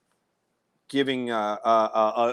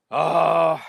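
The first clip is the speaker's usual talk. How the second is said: mock gloomy dad; 3 semitones higher than usual. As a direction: imitate a dad with mock world-weary resignation. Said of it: "my dad was a ray of sunshine".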